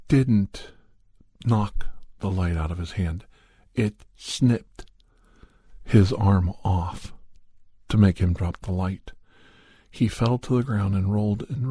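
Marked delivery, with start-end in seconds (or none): 3.7-7.1 "It snipped his arm off" slowed down, "snipped" and "arm off" punched; stress on snipped. A narrator's reading. Narrator correcting the listener, stressing "snipped his arm off". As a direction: lean hard on "snipped" and draw out "his arm off" so no one mistakes it. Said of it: "Now, when I say it snipped it".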